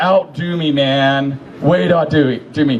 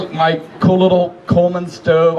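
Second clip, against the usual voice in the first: ordinary talk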